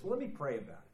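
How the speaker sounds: evasive excuse. Retold or imitated. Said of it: "you'll say something like this".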